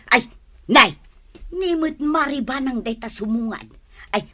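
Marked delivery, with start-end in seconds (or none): none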